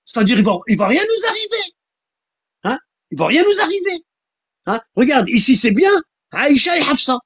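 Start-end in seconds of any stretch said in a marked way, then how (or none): 0.4-2.8 mock carefree voice. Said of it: "Eux, ils vont se dire". 3.1-4.8 mock carefree voice. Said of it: "Eux, ils vont se dire".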